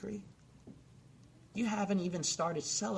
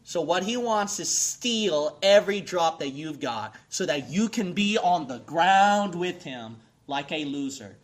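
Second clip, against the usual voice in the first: preaching at full force; 3 semitones higher, 12 dB louder than usual. Acted. Preacher warning the congregation about Satan, shouted. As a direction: pound it out from the pulpit.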